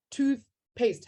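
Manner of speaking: TOOTHPASTE in two slow beats, TOOTH, pause, PASTE; split into syllables. Shown as a demonstration. Teacher counting syllables for the class, splitting toothpaste in two. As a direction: separate "tooth" from "paste" with a short gap. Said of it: "has two sylla".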